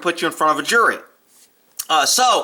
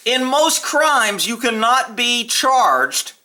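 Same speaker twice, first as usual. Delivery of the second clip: slow, higher, leaning hard on charged; emphasis on charged; 4 semitones higher, much slower than usual. Shown as a demonstration. Ex-cop stressing a legal point to viewers, slow emphasis on charged. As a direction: draw out you cannot be charged, landing on charged. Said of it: "emphasis the word charged".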